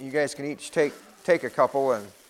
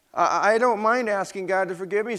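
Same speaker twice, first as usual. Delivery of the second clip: put-on a crying child pleading, higher; 6 semitones higher, 5 dB louder than usual. Peter pleads with Grandma, mildly tearful. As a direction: Peter answering Grandma through tears, a touch of crying. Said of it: "said Peter, crying".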